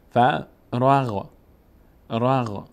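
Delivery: فراغ drawn out very slowly, sounds joined; slow blended reading. Shown as a demonstration. Teacher models فراغ very slowly for learners. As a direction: stretch فراغ slowly so the ر leans on the غ. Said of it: "ر باید بچسبه به غین".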